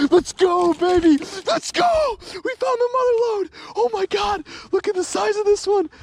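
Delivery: high, loud ecstatic yelling at a big fish. Real, not acted. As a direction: cheer like you just landed a monster. Big fish landed, ecstatic cries.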